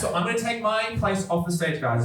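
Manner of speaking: ordinary talk, nothing marked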